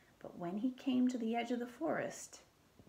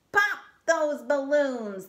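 loud slow angry command; shouting; 4 semitones higher, 10 dB louder, slower than usual. Acted. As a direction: bellow it at his men. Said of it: "the Archduke shouted to his men".

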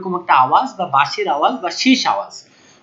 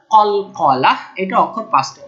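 normal talking voice

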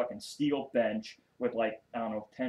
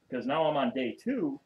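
ordinary talk

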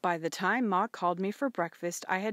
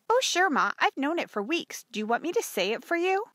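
put-on high, quick girl's voice; eager young daughter; 9 semitones higher, 5 dB louder than usual. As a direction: answer Ma eagerly, pitched well up.

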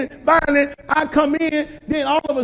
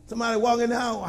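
ordinary talk, nothing marked

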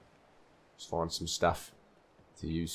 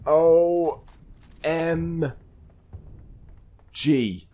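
spelled-out disbelief; 8 semitones higher, 6 dB louder, much slower than usual. Real, not acted.

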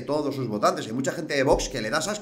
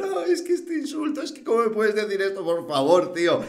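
high whining parody of a crybaby; whiny mock voice; 9 semitones higher, 3 dB louder than usual. Mocking crybabies who take offence, high whiny voice. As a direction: whine it like an offended snowflake. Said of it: "Ya están llorando".

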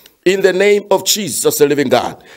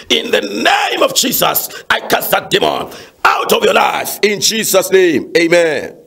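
commanding prayer, higher than chat, key words stretched; forceful deliverance prayer; 4 semitones higher than usual. Real, not acted. Pastor praying over a caller's troubled marriage, forceful commanding prayer.

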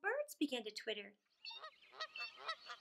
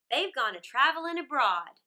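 put-on louder, bold teasing robber girl; brash robber girl; 8 dB louder than usual. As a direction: tease him like a cocky bandit girl. Robber girl answers Gerda.

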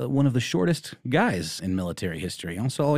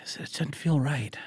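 whisper; 6 semitones higher, 4 dB quieter than usual. Real, not acted. Whispered aside about absent friend.

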